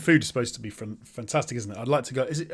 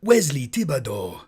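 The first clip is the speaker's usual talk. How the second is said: Wesley Thibodeau said with a Cajun French lilt, stretched out; tentative Cajun French take on Wesley Thibodeau; Cajun French accent. Shown as a demonstration. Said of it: "say Wesley's name in the accent".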